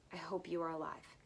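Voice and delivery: whispered, barely voiced; softly whispered hope. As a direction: breathe the last line like a prayer. Emotional end of diary reading.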